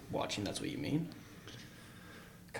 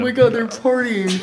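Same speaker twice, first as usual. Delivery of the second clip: high, loud pretend amazement; mock excited gasp; 12 semitones higher, 12 dB louder than usual. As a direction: play a peeper thrilled by the party. Acting a peeping people watcher.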